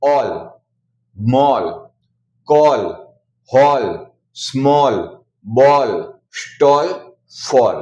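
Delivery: ऑल, मॉल, बॉल, फॉल said with an open "aw" vowel; aw sound demo. Shown as a demonstration. A teacher drilling the double-L rule: a clear "aw" vowel. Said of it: "ए को ओ पढ़ते हैं".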